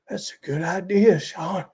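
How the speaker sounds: high, soft put-on buddy voice; character dialogue. Tommy agreeing with Sean, high character voice. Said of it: "how a single person does dialogue".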